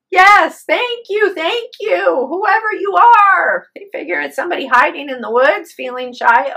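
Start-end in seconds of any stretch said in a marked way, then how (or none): 0.1-3.7 high, loud, slowing at the end; calling thanks. Retold or imitated. Husband calling thanks to whoever saved them, loud. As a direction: shout gratitude into the night. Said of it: "the guy follows suit".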